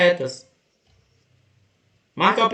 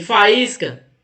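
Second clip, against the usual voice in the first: 1 slow reading. Shown as a demonstration. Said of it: "reading quiz word slowly".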